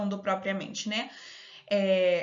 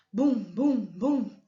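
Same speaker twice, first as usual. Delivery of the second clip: separate, booming drum beats; comic-book drum noise; imitated drumbeat; much slower than usual. Shown as a demonstration. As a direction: sound out each thump of the drum. Reading out drum sound effect.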